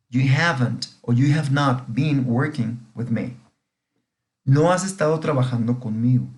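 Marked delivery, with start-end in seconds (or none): 0.1-3.4 slow, careful English example, each word clear; slow model reading. Shown as a demonstration. Teacher repeating the example sentence slowly for students. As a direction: spell it out slowly for the class. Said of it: "esta frase dice".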